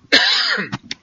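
a short dry cough. Real, not acted. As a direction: clear a dry throat with a cough.

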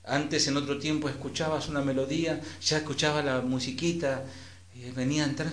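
ordinary talk

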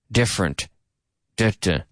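DIFFERENT as DIF-rent, then its two beats; two-syllable rhythm. Shown as a demonstration. Teacher beating out the word, two syllables. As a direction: say different and tap it out as "duh, duh". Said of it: "Two syllables".

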